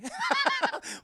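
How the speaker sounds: real high-pitched giggle; high laugh. Real, not acted. Reviewer cracking up at Peter's question, high laugh. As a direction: burst out laughing.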